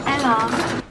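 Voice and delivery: lightly imitated Manchester accent. Friend teasing about the check-in man, light Manchester accent. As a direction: echo "Ella" in mock Mancunian. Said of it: "I forgot he had an accent".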